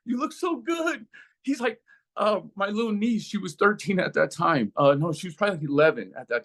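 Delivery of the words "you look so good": put-on excited child greeting. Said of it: "they're like".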